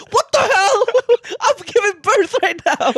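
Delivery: very high, squealed imitation of outrage; mock outraged mom. Mom in labour to stalling dad, mock outrage. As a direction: imitate a labouring mom losing it, half laughing.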